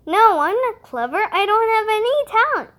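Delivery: flustered rat. Acted. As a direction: brush off praise, flustered.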